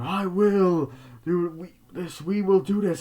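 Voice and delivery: high, slow, loud declaiming; mock proclamation. Guy on the film's TV, mock proclamation. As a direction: proclaim it grandly, stretching the words.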